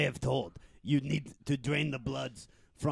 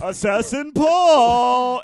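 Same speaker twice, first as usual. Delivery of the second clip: high, slow, loud name call; shouted high announcement; 9 semitones higher, 11 dB louder, much slower than usual. Acted. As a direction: belt out the name. Roll call in character voice.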